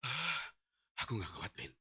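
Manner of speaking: very soft, low, spent gasp; struggling for breath. Retold or imitated. Failing husband to his wife, breathless murmur. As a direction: barely push the words out. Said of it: "berjuang untuk ngambil nafas susah".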